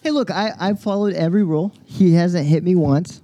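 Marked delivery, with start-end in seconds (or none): none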